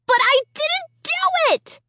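high, furious outburst; raging. A narrator's reading. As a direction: rage the denial. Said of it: "I raged".

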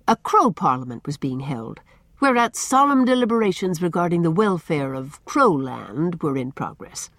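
plain reading voice

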